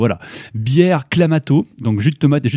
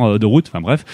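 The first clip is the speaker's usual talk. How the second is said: ordinary delivery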